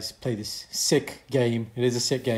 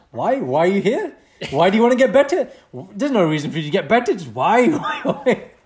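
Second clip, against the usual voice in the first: high, rising badgering whys; mimicked insistent questioning; 6 semitones higher, 5 dB louder than usual. Teasing his friend's feedback habit, high insistent questioning. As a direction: keep probing, higher each burst, breaking into laughter. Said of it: "he'll be like".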